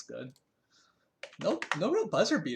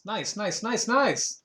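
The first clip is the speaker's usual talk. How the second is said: nices climbing higher and louder; building nice; 8 semitones higher, 7 dB louder, much slower than usual. Real, not acted. Player celebrating his score, building excitement. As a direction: pump yourself up, each nice bigger.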